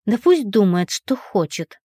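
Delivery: plain reading voice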